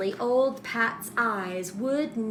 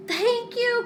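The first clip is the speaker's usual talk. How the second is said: much higher, squeaky thanks; leprechaun crying out; 4 dB louder than usual. A narrator's reading. The freed leprechaun thanking old Pat in a much higher cry. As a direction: burst out in grateful relief. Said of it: "cried the leprechaun".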